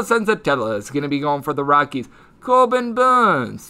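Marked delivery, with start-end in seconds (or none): 2.4-3.7 slow, drawn-out, mock English accent, pitched up. Shown as a demonstration. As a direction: try the name in a posh English accent, half-jokingly. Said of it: "his name should be said in an English accent".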